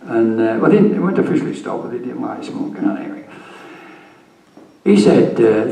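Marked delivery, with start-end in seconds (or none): none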